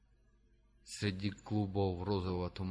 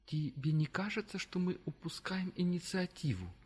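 higher side-character voice; 9 semitones higher than usual. A narrator's reading. Dale's voice.

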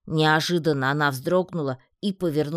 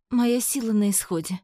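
high, spent Amazon voice; sorrowful; 9 semitones higher than usual. A narrator's reading. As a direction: grieve high as strength runs out. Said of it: "горестно выдохнула амазонка".

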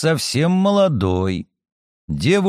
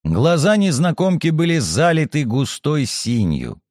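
plain reading voice

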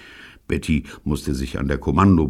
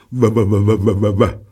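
rapid stuttered shivering noise, raised and loud; chattering teeth; 5 semitones higher, 6 dB louder than usual. A narrator's reading. Jean freezing on deck, teeth chattering. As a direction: imitate teeth chattering with cold. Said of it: "klapperte mit den Zähnen".

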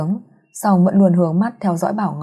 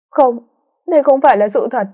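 high, louder and fast distraught cry; girl breaking down over her mother's body, high and fast; desperate denial; 6 semitones higher, 4 dB louder than usual. A narrator's reading. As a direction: refuse the truth in panic.

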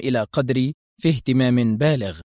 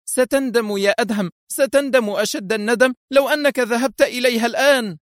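very high, vehement threat; fierce; 10 semitones higher than usual. A narrator's reading. Wife warns husband he will regret going, fierce. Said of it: "كل شراستها وعنفها، وهي تهتف".

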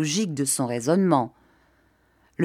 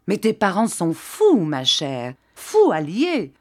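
angry rant; 4 dB louder than usual. A narrator's reading. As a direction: hammer home that they are mad. Julien rants to wife about money.

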